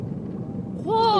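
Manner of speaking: high, loud disbelief; shocked what. Real, not acted. Hearing Sarah pedalled downhill, shocked outburst. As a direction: shout out in amazement. Surprised at the wind story.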